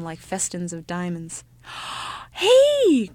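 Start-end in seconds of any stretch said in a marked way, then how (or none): 1.7-2.3 sharp intake of breath; excited gasp. Acted. Child gasps at a discovery. 2.3-3.1 put-on very high, loud child's shout; excited cry. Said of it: "cried the ugly child".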